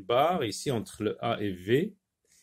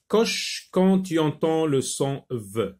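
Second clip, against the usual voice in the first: slow, clear classroom reading; reading the instruction; 4 semitones higher than usual. Shown as a demonstration. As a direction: read the instruction slowly for pupils. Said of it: "reads the exercise instruction". (lecture lente de la consigne)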